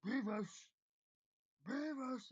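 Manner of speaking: mock ogre yelling for his friend; ogre shouting. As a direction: ogre shouts a name across the cave. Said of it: "he shouts".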